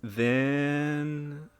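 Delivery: long drawn-out, hesitant word; sadly. A narrator's reading. Mother reluctant to answer children, sadly. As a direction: stretch it out reluctantly. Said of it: "said the mother sadly".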